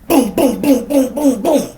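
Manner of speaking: high, loud boom boom imitation; gunshot sounds. Narrator imitating the woman firing, high loud booms. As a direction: mimic the shots with your voice.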